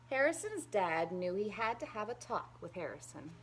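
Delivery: ordinary delivery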